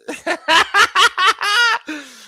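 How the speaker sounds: high, loud burst of laughter; real uncontrolled laughing fit; cracking up. Real, not acted. Host cracks up at his own innuendo, laughing loudly.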